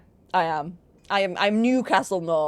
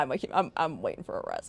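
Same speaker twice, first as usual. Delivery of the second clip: ordinary talk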